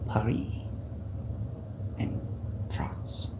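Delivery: very soft, hushed scene-setting; quiet relaxation-video murmur; mock ASMR. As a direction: let each phrase drop away softly between long pauses. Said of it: "We're gonna do an A S M R video".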